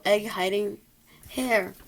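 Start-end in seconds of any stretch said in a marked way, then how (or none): none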